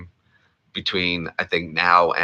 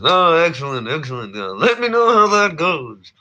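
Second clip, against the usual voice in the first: pompous millionaire, delighted; Thurston Howell impression; 9 semitones higher, 4 dB louder than usual. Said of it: "that's Thurston Howell the third".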